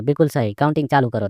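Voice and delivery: ordinary delivery, nothing marked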